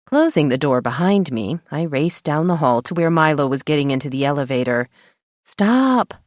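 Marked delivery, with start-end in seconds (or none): 5.4-6.1 sharp high yell. A narrator's reading. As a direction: call out to stop him. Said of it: "I yelled".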